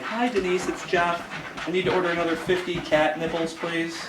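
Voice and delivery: politely ordering cat nipples over the phone, lightly chipper. Retold or imitated. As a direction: sound breezy ordering something absurd. Acting out his phone order.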